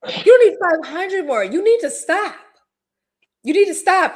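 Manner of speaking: high-pitched exasperated scolding; insistent pleading. Acted. Cohost telling a gambler to quit, insistent pleading.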